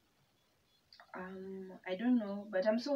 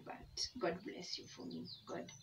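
whispering; 9 dB quieter than usual. Real, not acted. Heartfelt blessing, softly said.

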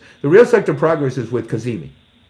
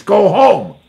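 higher, louder put-on voice telling them off; mock shout; 7 semitones higher, 8 dB louder than usual. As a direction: shoo them away loudly. Mocking dismissal, far voice.

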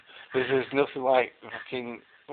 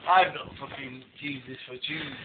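ordinary talk

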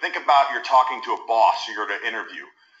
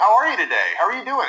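high-pitched approval-seeking greeting; supplicating, careful not to ruffle feathers; sales-call friendliness; 8 semitones higher, 4 dB louder, faster than usual. Shown as a demonstration. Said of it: "It sounds like a sales call almost".

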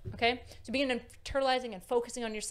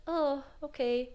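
mild, deflated meekness; meek; 3 semitones higher than usual. Acted. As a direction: slowly draw out the oh, like giving up. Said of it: "the meek one".

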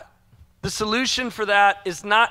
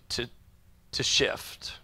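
whispered; 9 semitones lower, 11 dB quieter, slower than usual. Real, not acted. Preacher whispers the word to his listeners. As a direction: drop to a bare whisper. Quiet climax of a sermon line.

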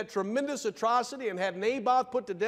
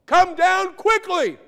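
commanding shout; 10 semitones higher, 9 dB louder, slower than usual. Retold or imitated. Preacher voicing the king's command.

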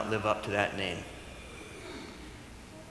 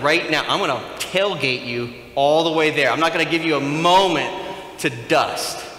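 loud, raised-pitch comic demand; pushy insistence; 4 semitones higher, 8 dB louder than usual. Acted. Pastor inviting himself over after church, loudly insistent. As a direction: hammer it out with no room for argument. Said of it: "I'd be like".

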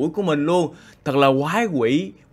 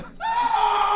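eerie high laugh; 3 dB quieter than usual. Acted. Sudden eerie laugh at nightfall.

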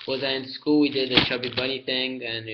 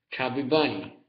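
talking with a full mouth; cheeks stuffed full. Real, not acted. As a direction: say it through cheeks full of marshmallows. Mouth crammed with marshmallows.

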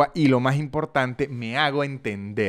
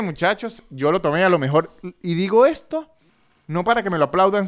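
ordinary delivery

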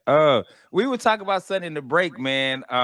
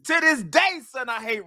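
mock outrage; 8 semitones higher than usual. Real, not acted. Mock grudge over Hot Rod.

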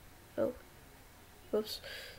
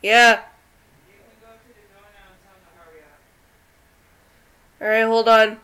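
loud, excited burst; excited shout; 18 dB louder than usual. Real, not acted. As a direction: shout it at the game. Excited outburst while gaming.

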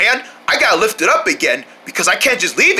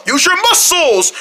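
high strained rallying call; urging through effort; 8 semitones higher than usual. Pretend. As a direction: urge the team on while heaving. Urging each other while carrying.

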